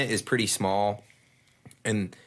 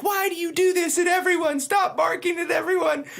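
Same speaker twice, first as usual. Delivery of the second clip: frantic pleading. Retold or imitated. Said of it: "she's like".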